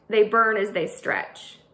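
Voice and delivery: ordinary delivery, nothing marked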